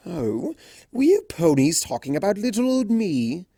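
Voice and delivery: grinning. A narrator's reading. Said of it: "with a toothy grin".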